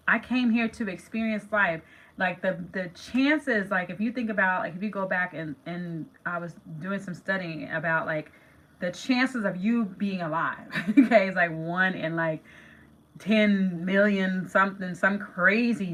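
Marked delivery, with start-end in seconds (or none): none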